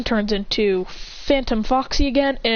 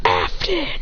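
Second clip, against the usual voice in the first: mock yell; 8 semitones higher than usual. A narrator's reading. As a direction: call the name like an angry cry, held back. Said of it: "Freddy yells".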